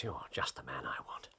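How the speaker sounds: low breathy whisper; whispered aside, having found the killer. Acted. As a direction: whisper it to yourself. Aside once the harpooner is identified.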